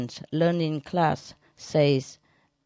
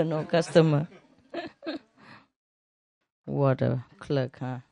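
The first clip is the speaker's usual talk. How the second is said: ordinary talk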